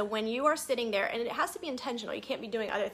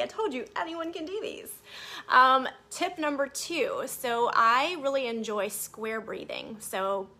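ordinary talk

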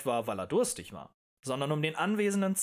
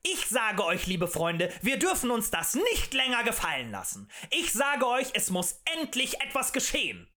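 impassioned tavern agitator; rabble-rousing harangue; 9 semitones higher than usual. A narrator's reading. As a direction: stir up a crowd with indignation. Agitator urges crowd to act.

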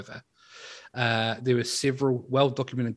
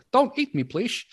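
high pleading sailor; 12 semitones higher, 5 dB louder, slower than usual. Retold or imitated. Voicing a pleading victim.

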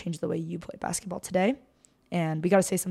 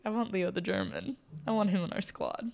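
hushed, under the breath; quiet; 9 dB quieter than usual. Retold or imitated. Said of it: "I almost made the most heinous comment".

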